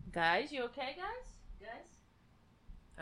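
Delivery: hushed call to sleeping cats; soft calling, almost a whisper; calling softly. Real, not acted.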